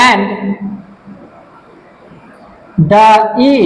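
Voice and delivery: slow word-by-word dictation with long pauses; dictating while writing. Real, not acted. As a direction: spell it out piece by piece for students copying it down. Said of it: "আমি লিখে দিচ্ছি". (লিখতে লিখতে থেমে থেমে, ধীরে বলে দেওয়া)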